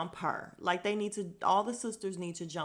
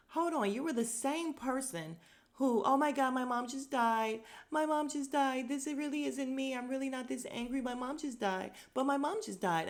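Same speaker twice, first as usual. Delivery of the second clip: high, whiny mocking repeat of my mom just died; mocking Rena; 7 semitones higher than usual. Host mocking Rena's repeated grief excuse to Kathy. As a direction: parody her excuse, dripping sarcasm. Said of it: "not making fun of the fact that her mom died".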